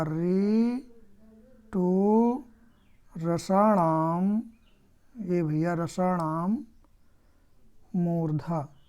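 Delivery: very slow, drawn-out syllables; slow dictation. Shown as a demonstration. Teacher dictating the retroflex sutra to students, slow chant-like dictation. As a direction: stretch each word of the sutra.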